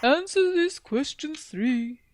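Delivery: high, slow, booming spirit voice; nightmare spirit. Retold or imitated. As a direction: intone it like an eerie game-show spirit. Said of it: "I'll give you directions in the Nightmare voice".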